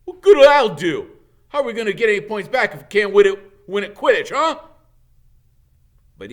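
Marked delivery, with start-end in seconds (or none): 0.1-4.6 loud, high, fast furious rant; angry captain shouting; thundering. A narrator's reading. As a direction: blast him with outrage. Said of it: "Wood thundered".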